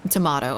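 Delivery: tomato said tuh-MAH-toe; tomato with a broad ah, posh; tomato in a mock posh British way. Shown as a demonstration. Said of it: "it sounds posh".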